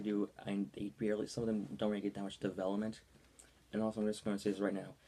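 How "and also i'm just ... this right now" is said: ordinary talk, nothing marked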